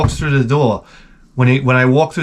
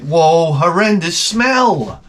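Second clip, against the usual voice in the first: climbing from high to very high, slow, hammed-up gagging disgust; disgusted outcry; 13 semitones higher, much slower than usual. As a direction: drag out the horror as if about to retch. Stepping in dog mess.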